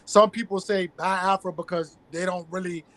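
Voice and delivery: ordinary talk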